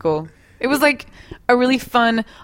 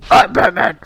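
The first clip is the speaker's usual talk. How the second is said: breathy, louder Batman rasp; hammy superhero growl; 7 dB louder than usual. Pretend. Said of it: "I sound more like the movie trailer guy".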